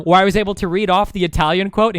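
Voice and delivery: ordinary talk, nothing marked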